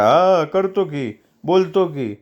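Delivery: string the caller along, slowly; slow evasive phone reply, stalling for time; slow fob-off. Acted.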